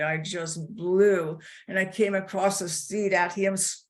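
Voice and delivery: usual speaking voice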